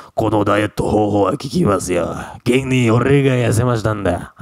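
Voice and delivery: gruff male take on the diet line; man's voice. Acted. Teacher contrasting male speech in a diet ad. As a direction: say it like a dude bragging. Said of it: "Kalau laki-laki kan nggak enak".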